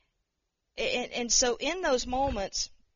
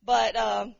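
ordinary delivery

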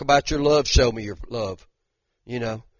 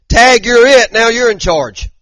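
loud, high-pitched call, imitating a game of tag; exclaimed handover; 8 semitones higher, 9 dB louder than usual. Preacher voicing Jesus leaving for heaven, exclaimed handover. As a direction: call it out big, like passing the baton. Acts out Jesus handing over charge.

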